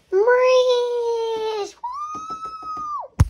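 squealing cheer. Acted. Guests cheer to start party.

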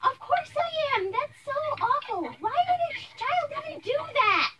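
high-pitched indignant dismay; outraged. Acted. Mother reacting to her son being bullied, outraged.

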